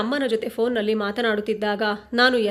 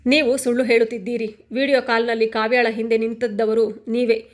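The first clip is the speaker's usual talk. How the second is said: usual speaking voice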